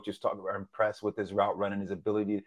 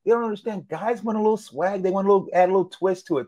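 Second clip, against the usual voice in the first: fired-up defense; 10 semitones higher, 8 dB louder than usual. Real, not acted. Cohost hyped defending swagger.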